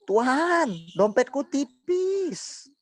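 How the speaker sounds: high, slow whiny plea; high drawn-out mock prayer; mock pleading prayer. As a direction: whine to God about a thin wallet. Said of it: "Kata si Debbie, dia doa".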